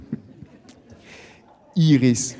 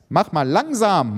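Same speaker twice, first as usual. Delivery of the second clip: higher-pitched mild warning; admonishing; 7 semitones higher than usual. A narrator's reading. Anna cautioning the quarrellers, admonishing. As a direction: urge someone to ease off. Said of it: "mahnt Anna".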